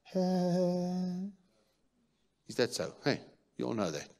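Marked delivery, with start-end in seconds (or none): 0.0-1.3 high squealing baby cry, mock; hammed-up toddler tantrum noise; baby tantrum yell. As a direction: imitate a baby's tantrum wail. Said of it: "he sounds like".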